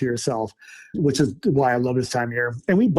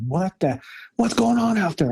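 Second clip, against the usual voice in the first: higher, startled mock wife voice; alarmed wife; 5 semitones higher than usual. Imitating his wife asking what the noise is, startled and higher. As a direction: imitate the wife's alarmed question, pitched up. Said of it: "And now my wife's going".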